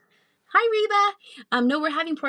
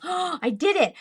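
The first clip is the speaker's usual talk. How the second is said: excited; 9 semitones higher, slower than usual. Real, not acted. Gasps after reading the name.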